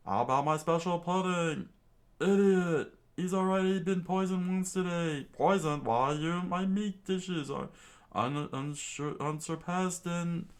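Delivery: high, loud squabbling voice; bickering cooks. A narrator's reading. Cooks arguing over Sanji's food, high loud bickering.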